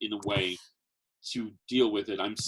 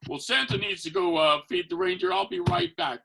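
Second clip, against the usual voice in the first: bright, raised Santa voice, mildly hammed; jolly Santa excuse; 5 semitones higher, 4 dB louder than usual. Santa excusing himself to a child, cheerful and raised. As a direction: cheerily cover a choking fit as Santa.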